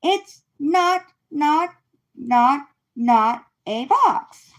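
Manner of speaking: insist, not, not, not a box; insistent repeats. Acted.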